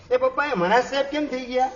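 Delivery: put-on high, childlike asking voice; a small boy tugging at his father with a question; curious little son. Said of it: "તમારો દીકરો પૂછે". (નાના દીકરાનો ઊંચો, કુતૂહલભર્યો અવાજ)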